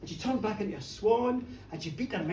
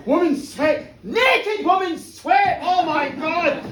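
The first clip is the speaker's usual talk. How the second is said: higher, louder and slow, building to a shout; lustful excitement; 6 semitones higher, 8 dB louder, slower than usual. Retold or imitated. Connor thinking as the sweat splats on him, a slow excited shout. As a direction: savour each word, then lose control. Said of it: "He's thinking".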